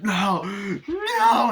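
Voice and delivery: high, loud sobbing no; imitated crying plea. The doused cop crying out to his captor, sobbing.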